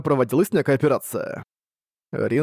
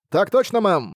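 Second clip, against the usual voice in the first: crisp military reply; 7 semitones higher than usual. Acted. Crew answers captain over radio.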